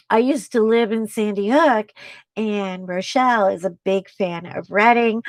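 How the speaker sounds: ordinary talk, nothing marked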